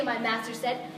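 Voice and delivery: ordinary talk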